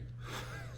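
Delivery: short burst of real laughter; amused laugh. Real, not acted. Laughing at his own story title. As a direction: laugh at the memory.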